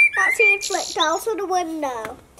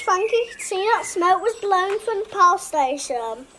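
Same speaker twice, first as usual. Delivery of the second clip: usual speaking voice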